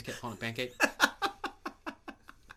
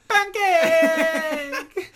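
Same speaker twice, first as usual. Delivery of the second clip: much higher, louder cry of the name; loud mourning cry; 13 dB louder than usual. Retold or imitated. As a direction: call out the name in grief, laughing. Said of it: "one guy's like".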